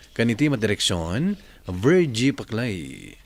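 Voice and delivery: slow, grand closing of a radio drama; radio drama announcer. Acted. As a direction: sign off the episode like a radio announcer. Radio drama host closes the episode.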